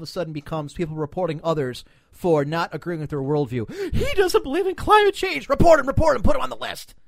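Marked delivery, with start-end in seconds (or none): 3.9-7.0 high, loud hysterical tattling; frantic hammed-up cry to report someone; mock outrage. Imitating an outraged user reporting someone, high mock hysteria.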